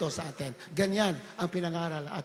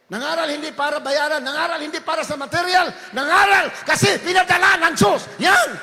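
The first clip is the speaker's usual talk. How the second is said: loud, high shouted sermon; fiery preaching; 10 semitones higher, 11 dB louder than usual. Acted. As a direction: preach it out at full force. Preaching at full force. (sumisigaw na pangangaral)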